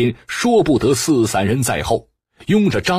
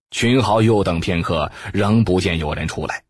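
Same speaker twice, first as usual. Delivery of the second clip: plain reading voice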